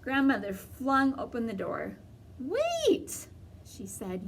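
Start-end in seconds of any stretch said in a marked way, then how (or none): 2.4-3.3 startled. Acted. Grandmother alarmed at the wolf.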